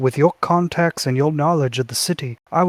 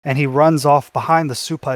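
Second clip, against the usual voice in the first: normal talking voice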